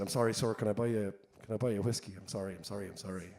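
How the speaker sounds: embarrassed apology. Acted. Irishman apologising to the Californian he misunderstood, embarrassed and fading. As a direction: mumble repeated apologies as they trail away. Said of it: "the Irishman was very embarrassed".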